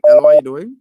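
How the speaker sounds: loud call into a bad phone line; loud hello. Real, not acted. Host calling loudly down a weak line. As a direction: raise the voice to reach the caller. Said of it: "host repeats greeting on a bad line".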